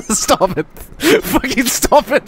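high words through helpless laughter; laughing protest. Real, not acted. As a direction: beg it to stop while cracking up. Begging it to stop while cracking up.

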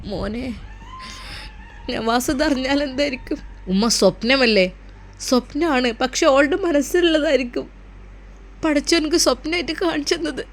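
frightened mother telling her son the dream, softly weeping; crying mother. Acted. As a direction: speak through quiet tears of fear. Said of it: "ഉമ്മ കരയാതിരിക്കൂ".

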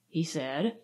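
usual speaking voice

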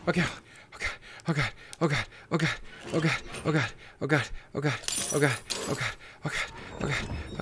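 hushed, panting oh-Gods; frightened muttering. Real, not acted. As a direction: repeat it under your breath in dread. Dread after recognising the place.

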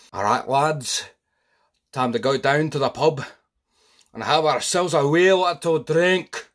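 put-on hearty Scottish pub-goer accent; rally the lads to the pub in a Scottish accent. Man calling his mates down to the pub, cheerful Scottish accent.

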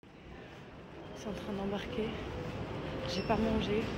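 worn out, breathless and quiet; drained, barely getting words out; exhausted. Real, not acted. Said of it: "je peux plus".

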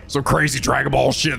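usual speaking voice

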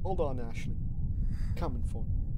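put-on threatening in an Indian accent. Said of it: "It's really the full Indian accent".

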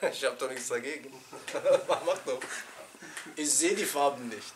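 ordinary talk

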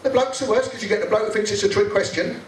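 normal talking voice

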